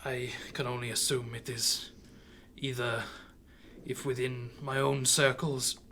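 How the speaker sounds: lightly voiced frail old man, quiet; halting, subdued delivery with long pauses; weary old man. Old man answering the party's questions, quiet and hesitant.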